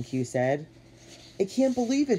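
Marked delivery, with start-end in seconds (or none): 1.4-2.2 high-pitched mild sigh of disbelief; dismayed sigh. A narrator's reading. Hooter realizing they may be stranded, dismayed sigh. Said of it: "Hooter sighed".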